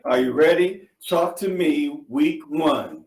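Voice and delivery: energetic host rallying the audience; hyped-up call to the audience. Real, not acted. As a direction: get the crowd fired up. Hyping up the workshop audience.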